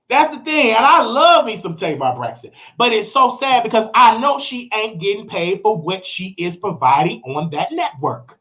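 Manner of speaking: ordinary delivery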